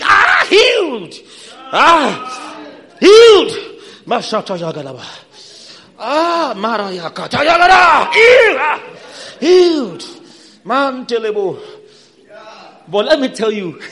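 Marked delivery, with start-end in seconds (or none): 0.0-11.6 high, loud triumphant shouting; shouting faith healer. Shown as a demonstration. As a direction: shout the healing out with full faith. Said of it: "You hit your leg".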